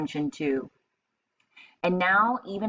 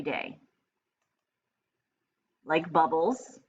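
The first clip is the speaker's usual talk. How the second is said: storybook read-aloud for a class; lively picture-book narration; reading to children; 2 semitones higher than usual. Acted. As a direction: read it like story time on the rug. Teacher reads picture book aloud.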